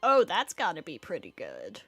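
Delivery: usual speaking voice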